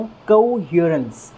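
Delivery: कोहेरेंस slowly, every sound clear; slow model word. Shown as a demonstration. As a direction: say कोहेरेंस syllable by syllable. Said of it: "इस word का pronunciation".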